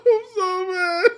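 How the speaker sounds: very high, loud, drawn-out wail through laughter; mock crying. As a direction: wail it like an outraged little kid, half laughing. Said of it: "I started crying".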